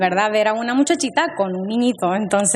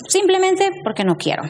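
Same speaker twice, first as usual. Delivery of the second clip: mother's refusal; 10 semitones higher than usual. Retold or imitated. Mother refusing church outing.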